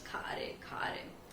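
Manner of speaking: slow unwilling whisper while chewing; reluctant whisper. Shown as a demonstration. Teacher shows students eating disliked food, slow whisper. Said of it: "जब कुछ चीज पसंद नहीं आती है".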